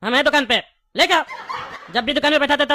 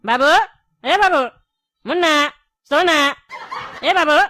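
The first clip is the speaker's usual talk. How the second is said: put-on calling out to wake a child, slightly raised; ज़ोर से पुकारना. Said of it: "इतना जोर जोर से बोल रहा है". (सोते बच्चे को जगाने की हल्की ऊँची पुकार)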